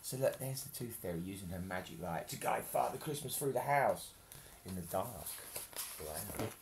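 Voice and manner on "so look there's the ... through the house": plain reading voice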